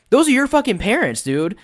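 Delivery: high, loud outraged yell. Retold or imitated. Player yelling at friend over parents, outraged. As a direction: yell at him in disbelief.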